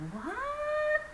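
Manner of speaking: very high, rising astonished What?; shocked, high-pitched What?; high surprised what. Shown as a demonstration. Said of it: "in a different tone".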